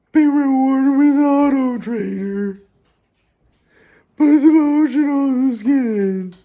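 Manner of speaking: very high, slow, loud creepy voice; drawn-out high creep, rising to loud; Buffalo Bill voice. Retold or imitated. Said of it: "The Buffalo Bill voice".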